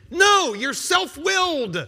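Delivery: loud, high, slow no; shouted rebuttal. Acted. Preacher shouting down a listener's excuse, loud and slow.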